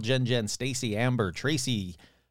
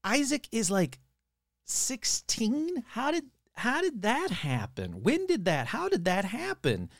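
mock disbelief; 6 semitones higher than usual. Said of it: "And I'm like".

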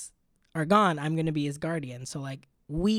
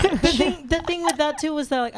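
mock high voice; 10 semitones higher, 3 dB louder than usual.

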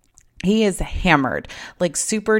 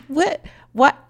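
disbelief; 10 semitones higher, 4 dB louder than usual. Real, not acted. Disbelief at his text.

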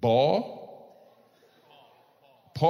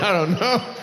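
mock nervous lady voice; 6 semitones higher than usual. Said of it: "She's like".

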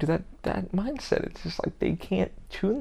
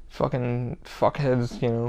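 ordinary delivery

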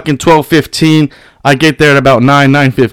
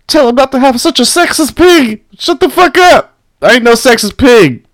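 high whiny mock voice of a complainer; 9 semitones higher than usual. Mocking the online complainers in a high whiny voice. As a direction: squeak it like an offended critic. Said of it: "People are like".

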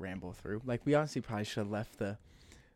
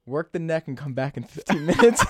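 higher, louder, cracking up on the punchline; breaking into laughter; 10 semitones higher, 10 dB louder than usual. Retold or imitated. Retelling a comedian's bit to co-host, laughing. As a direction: laugh through the end of the joke.

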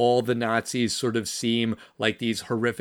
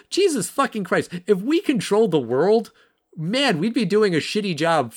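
much higher, louder outburst of disbelief; exasperated; 7 semitones higher, 3 dB louder than usual. Real, not acted. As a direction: throw up your hands at the absurdity. Exasperated at antisemitic conspiracy claims.

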